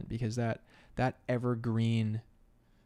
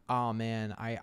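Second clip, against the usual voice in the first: ordinary talk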